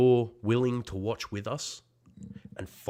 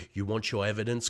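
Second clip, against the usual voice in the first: usual speaking voice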